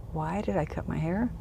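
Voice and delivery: soft, retold thought; puzzled inner echo. Woman wondering why she cut her hair, soft puzzled echo. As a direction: echo his question quietly to yourself.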